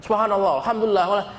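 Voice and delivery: imitated clamorous recitation; mock shouted praise. Shown as a demonstration. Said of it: "nggak usah teriak-teriak".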